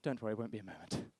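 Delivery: low, hushed doctor's reassurance; reassuring doctor. Acted. Doctor to the waiting partner, low soft reassurance. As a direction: play the doctor soothing softly and low. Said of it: "He goes".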